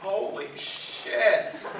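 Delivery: slow, drawn-out mock disbelief; retold disturbed shock. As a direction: stretch it out slowly, imitating stunned parents. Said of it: "they looked really disturbed".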